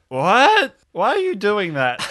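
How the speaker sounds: high, loud incredulous question; baffled. Acted. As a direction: demand an explanation, dumbfounded. Character turns round, baffled by accent.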